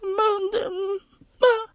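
small, high, soft mock sobs; quiet high-pitched moans; mock whimpering.

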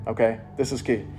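ordinary talk, nothing marked

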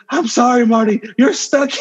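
high-pitched mock film scientist; high, playful quote of a dismayed inventor; imitating Doc Brown. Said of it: "and then Doc's like".

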